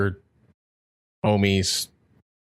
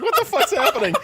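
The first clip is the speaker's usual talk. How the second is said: startled; 11 semitones higher than usual. Retold or imitated. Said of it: "I was like".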